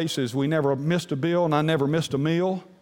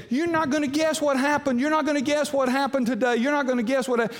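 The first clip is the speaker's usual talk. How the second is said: much higher, bubbling over; excited wife; 10 semitones higher than usual. Retold or imitated. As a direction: blurt it out like the excited wife on the porch. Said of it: "she's jumping up and down".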